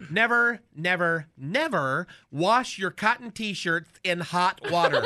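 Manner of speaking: ordinary talk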